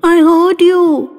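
put-on frightened feverish girl whimpering at her mother; whimpering child. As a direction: drag it out like a child about to cry. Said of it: "she whimpered".

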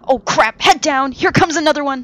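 loud, high warning yell over the storm; panicked cry of head down to the crew; alarmed shout. Acted. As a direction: bellow a warning as the wave hits. Wave crashing, sailor yells warning.

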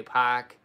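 "pak" with no R, open ah vowel; long ah, R silent in "pak"; Boston park. Shown as a demonstration. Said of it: "I say".

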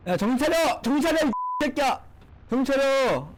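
high-pitched yells of panic at the screen; frantic yelling. Real, not acted. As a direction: yell at yourself to snap out of it. Yelling at his tank mid-battle. (게임 중 정신 차리라고 높은 소리로 외침)

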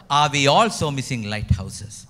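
ordinary talk

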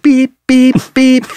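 sing-song backup alarm beeps; imitated backup beep. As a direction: mimic a reversing van's beep. Imitating van backup noise.

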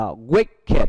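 "wicked" with an id ending. Shown as a demonstration. Teacher modelling "wicked" for students, id ending. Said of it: "E D được đọc là it".

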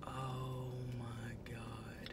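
hushed, breathless whisper. Real, not acted. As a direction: whisper it in awe. Whisper unvoiced while smelling food.

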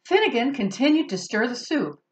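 plain reading voice

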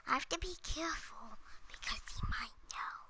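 hushed unvoiced whisper. Real, not acted. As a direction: whisper so nobody hears. Hiding from a friend in game.

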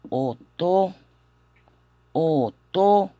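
"oto" slowly, starting straight on the vowel; H muda. Shown as a demonstration. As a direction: say "oto" as o-to, with no H sound. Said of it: "así que no suena". ("oto" con la H muda, o-to)

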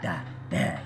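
low, soft put-on villain voice; the villain explains his name in a low put-on voice; mock villain correcting. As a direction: correct them low and smug. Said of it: "he's like".

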